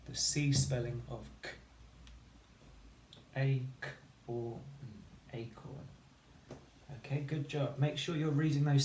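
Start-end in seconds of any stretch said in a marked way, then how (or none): 3.4-5.9 "A, k, or, n" segmented, then "acorn" blended and fading; sounding out acorn. Shown as a demonstration. Teacher modelling reading acorn sound by sound. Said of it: "say the sounds to read the word".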